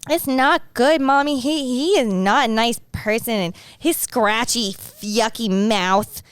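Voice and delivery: imitated little kid, slow and deliberate; small child earnestly tattling to Mommy; cute baby voice. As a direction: stretch the words like a disgusted toddler. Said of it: "Like a cute little baby boy".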